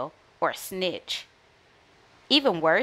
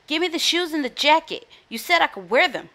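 raised, higher quarrelling voice; lightly acted shout, higher pitch; mild character shout; 7 semitones higher than usual. A narrator's reading. Norton arguing with his sister, mildly shouting. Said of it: "shouted Norton Snorton".

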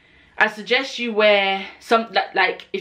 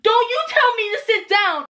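high, indignant inner voice; inner outrage. Retold or imitated. Thinking at the nurse, high indignant fury. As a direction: let the outrage squeak out high. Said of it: "I'm thinking".

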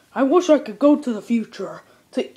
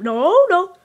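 ordinary talk

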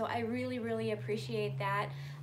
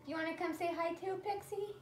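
soft, higher coaxing question; 4 semitones higher, 5 dB quieter than usual. Real, not acted. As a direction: gently coax the shy dog, softer. Coaxing shy dog Pixie.

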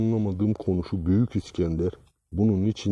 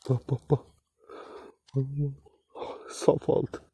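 whisper; 4 semitones higher, 7 dB quieter than usual. Real, not acted. Awed whisper over found gold.

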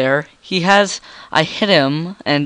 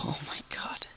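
whispering; 20 dB quieter than usual. Real, not acted. Excited whispering at game.

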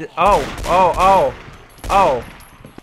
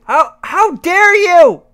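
high, loud slow rage shouts; shaking with anger; 12 semitones higher, 11 dB louder than usual. Real, not acted. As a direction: scream in frantic fury. Rage rant at game.

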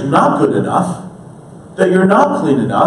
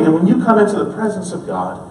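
ordinary delivery

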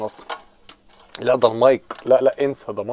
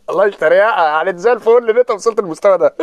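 mock rant; 7 semitones higher, 7 dB louder than usual. Mocking rant after the Ahly match.

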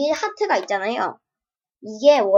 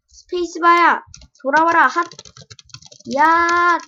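loud frustrated call, pitched up; yelling at the game; 6 semitones higher, 7 dB louder than usual. Real, not acted. Gamer yelling at his broken controls, loud and frustrated. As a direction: shout at the broken spacebar to come back.

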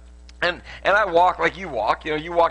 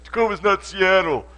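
mildly hammed-up indignant retort, higher pitched; mock angry protest; 6 semitones higher, 3 dB louder than usual. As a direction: imitate an annoyed local correcting someone. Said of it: "everybody gets angry with me".

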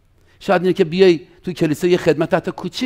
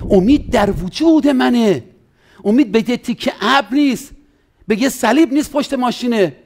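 fervent preaching; 6 semitones higher than usual. Real, not acted. Pastor urging the congregation that hope is within, fervent. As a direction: preach it with conviction.

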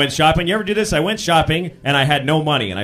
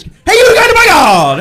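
very high, loud panicked shout; panicked yell; 13 semitones higher, 11 dB louder, slower than usual. Retold or imitated. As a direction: scream a warning in panic. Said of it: "What could I yell".

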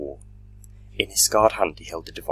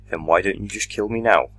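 defiant challenge; 4 semitones higher, 6 dB louder than usual. Acted. Doctor challenges the minister.

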